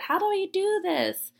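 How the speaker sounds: high-pitched, exaggerated helpless plea; mock frustration. Calling grandpa for help, mock frustrated. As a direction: retell it as a high, hammed-up cry for help. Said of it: "I was just frustrated".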